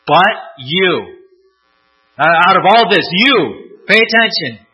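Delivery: rising to a loud, high insistent call on pay attention; emphatic call. Retold or imitated. Paul urging Timothy, building to a loud, high call. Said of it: "just as emphatic".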